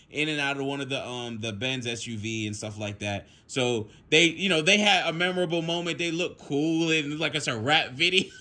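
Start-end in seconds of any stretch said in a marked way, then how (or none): none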